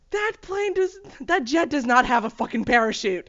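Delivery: mock him loudly ranting at the screen; husband's outburst. Quoting her husband yelling at the jet scene, loud and indignant. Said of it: "my husband will always say".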